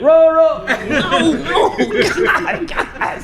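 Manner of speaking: put-on high squeaky kid singing off the beat, laughing; mocking the off-key student. Teacher mimicking a kindergartner ruining the round, high and laughing. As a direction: imitate the kid who sings out of turn, with a laugh. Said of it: "You always have that student".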